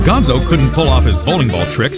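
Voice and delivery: usual speaking voice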